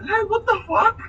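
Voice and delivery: high, loud disbelief; incredulous outburst. Retold or imitated. Storyteller replaying his thoughts at the police station, incredulous. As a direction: voice leaps up in acted shock. Startled thoughts acted out in retelling.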